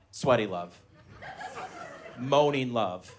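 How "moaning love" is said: ordinary talk, nothing marked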